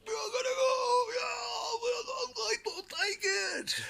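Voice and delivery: high, loud, crying shout of a spoiled brat; over-the-top screeching Don parody; Trump tantrum impression. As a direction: wail it like a toddler in a tantrum. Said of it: "screaming and shouting like a spoiled little brat".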